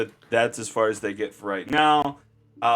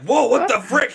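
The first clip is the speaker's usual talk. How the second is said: high yelping shock; startled yelp; 14 semitones higher, 6 dB louder than usual. Acted. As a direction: jump back in fright. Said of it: "this guy's like".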